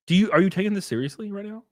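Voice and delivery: ordinary talk, nothing marked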